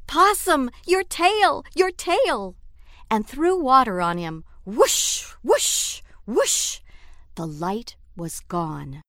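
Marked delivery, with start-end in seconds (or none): 0.1-2.5 high, loud alarmed cries; screaming. Acted. The animals scream at smoking Possum, alarmed. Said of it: "they screamed". 4.6-6.8 high rising whooshes; water whoosh. A narrator's reading. The narrator voices water thrown on Possum, whooshing.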